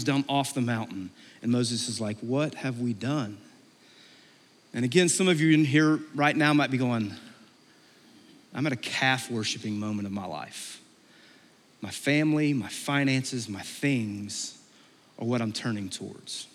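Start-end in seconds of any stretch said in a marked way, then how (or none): 2.2-3.4 low, slow, soft regret; hushed dismay. Retold or imitated. Moses seeing the calf. 8.5-16.5 low, soft admission; quiet confession. Retold or imitated. Imagined confession of a listener.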